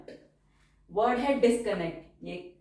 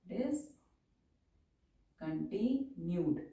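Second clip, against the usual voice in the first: every syllable stretched, softer; very slow dictation; 3 semitones lower, 7 dB quieter, much slower than usual. Shown as a demonstration. Teacher dictating a long word to students, very slowly. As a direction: spell it out by voice for the pen.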